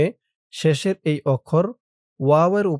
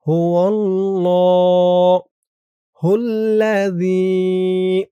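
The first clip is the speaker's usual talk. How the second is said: tajweed recitation; 3 semitones higher, 5 dB louder, much slower than usual. Shown as a demonstration. Teacher chanting for students to repeat. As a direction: stretch হুয়াল্লাহুল্লাযী long with the vowels held. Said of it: "বলুন আমার সাথে".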